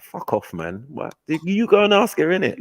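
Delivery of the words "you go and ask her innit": annoyed retort. Retold or imitated. Retorting to friend.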